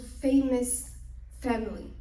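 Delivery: usual speaking voice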